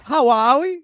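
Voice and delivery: high put-on Scouse greeting; Scouse accent. Shown as a demonstration. Reminding the panel of his contest entry, Scouse accent. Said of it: "at least I dropped".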